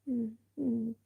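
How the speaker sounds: high soft grudging hum; sulky mumble. Retold or imitated. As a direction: grudgingly grunt like a sulking mum. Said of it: "doing the".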